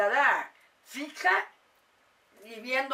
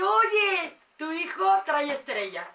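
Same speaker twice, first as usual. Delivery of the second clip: excited exclamation; 6 semitones higher, 5 dB louder, slower than usual. Retold or imitated.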